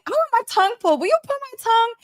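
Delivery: high, far-off put-on voice; asking Gary for the tongue pull, high and playful; mock sweet request. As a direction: beg him sweetly in a high voice.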